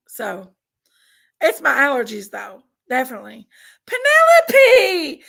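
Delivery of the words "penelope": high, slow, loud joyful call; excited call. Real, not acted. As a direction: call out the name with delight. Thanking a gifting viewer.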